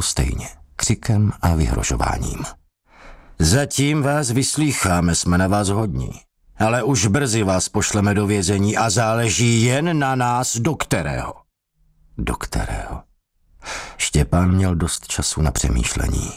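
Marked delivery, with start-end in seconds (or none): 3.4-11.3 veiled threat. Acted. Interrogator threatens with prison. 12.2-13.0 low, soft "Do kterého?" as an anxious thought; "Do kterého?" as a quiet worried inner voice; inner echo. Acted. Štěpán echoes the threat inwardly.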